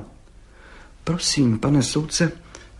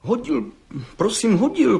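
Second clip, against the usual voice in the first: put-on high-pitched, flustered young man; stammering; 13 semitones higher, 3 dB louder than usual. A narrator's reading. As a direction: stammer out an insistent reply. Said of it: "koktal mladý cihlář". (koktavě, vysokým hlasem)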